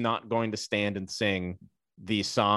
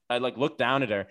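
ordinary talk, nothing marked

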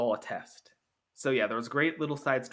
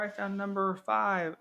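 put-on excited kid; 8 semitones higher, slower than usual. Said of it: "all the kids who won".

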